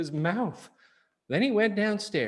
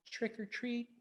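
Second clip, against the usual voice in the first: soft and much quieter; very softly; 7 semitones higher, 12 dB quieter than usual. Acted. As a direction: say it shyly under your breath. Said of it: "he said very softly".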